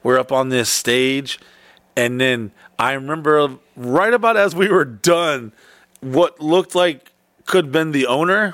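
usual speaking voice

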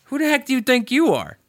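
hammed-up indignant challenge; mock indignant. Teacher snapping at cocky student, mock outrage. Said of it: "So he goes".

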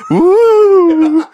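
high, loud ghostly "woo"; ghost wail. Retold or imitated. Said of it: "Her ghost voice is better".

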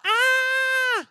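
high scared ah; scared cry. Shown as a demonstration. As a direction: cry out in fright. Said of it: "I want you guys to go".

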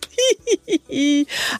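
giggle. Real, not acted. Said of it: "laughs at own pun".

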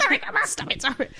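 very high, laughing protest; giggly mock apology. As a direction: laugh it off to grandma. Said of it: "I was like".

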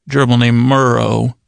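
ordinary talk

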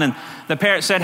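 usual speaking voice

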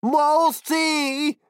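very high, loud, slow cry; frightened shout. Acted. Knight Barnabé stuck in the goo, frightened shout. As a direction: yell in alarm, stretching it out. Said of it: "cria Barnabé".

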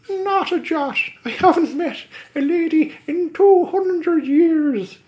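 high, loud, fast pleading stage Irish; leprechaun Irish accent. Acted. Leprechaun begs Bumfrey for release in Irish accent. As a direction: beg in a squeaky Irish leprechaun voice. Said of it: "lay on the accent so thick".

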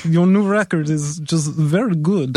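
put-on a lightly done Russian accent; mock Russian accent. To the songwriter, praising his record in mock Russian accent. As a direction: pay a compliment in a hammed-up Russian accent. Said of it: "I kept doing it in a Russian accent".

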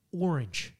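ORANGE softly, with American r; quiet American-accented "orange". Shown as a demonstration. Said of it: "one of those American words".

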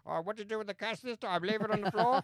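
teasing. Retold or imitated. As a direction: needle her with a high mocking voice. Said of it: "I kept teasing her about it".

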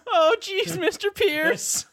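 very high, drawn-out whine; Morty. Acted. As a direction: fret pitifully in a squeaky high voice. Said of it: "It's Morty now".

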